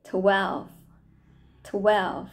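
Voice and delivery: ordinary delivery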